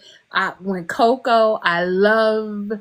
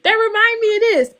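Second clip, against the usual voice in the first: much higher, bright and louder; remembering a joke with sudden delight; delighted recall; 13 semitones higher, 5 dB louder, faster than usual. Retold or imitated. Alone in the elevator recalling a joke, delighted. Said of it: "I was just like".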